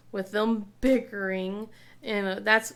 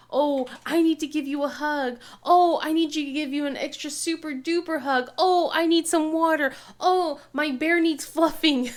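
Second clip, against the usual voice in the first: put-on higher, louder sweet wheedling kid; stalling daughter; 5 semitones higher, 4 dB louder than usual. Mom imitating daughter's bedtime stalling, sweet wheedling. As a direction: wheedle to stay up. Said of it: "She will find ways".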